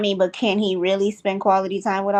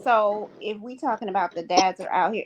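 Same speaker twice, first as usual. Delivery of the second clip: usual speaking voice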